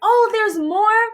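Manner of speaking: high, drawn-out fake delight; feigned excitement. Covering jealousy at her news, brightly feigned excitement. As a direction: push up a keen question you don't mean. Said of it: "trying to act like I'm excited".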